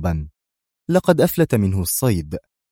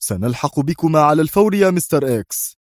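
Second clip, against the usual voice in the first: higher, eager call; 6 semitones higher than usual. A narrator's reading. Commander promises his boss to follow, calling out urgently. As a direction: call out a prompt reply. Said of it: "هاتفًا".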